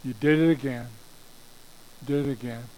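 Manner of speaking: low, resigned tut-tutting; fault-finding God. Acted. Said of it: "so he can say".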